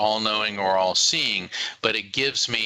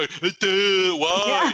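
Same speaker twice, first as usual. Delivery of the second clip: dopey mock why; 7 semitones higher, 3 dB louder, much slower than usual. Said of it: "don't sit there".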